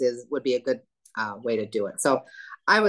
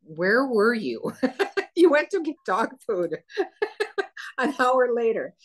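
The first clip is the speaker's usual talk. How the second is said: higher, giggling through a retold teasing question; laughing mock question; 8 semitones higher than usual. Mike teasing about the hour-long dog food trip, laughing. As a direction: tease him through laughter, imitating Mike. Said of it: "Mike was like".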